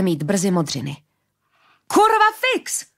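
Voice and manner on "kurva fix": high, slow, full-throated yell; bellowed curse. A narrator's reading. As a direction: howl the curse at the chaos. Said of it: "hulákal Moretti".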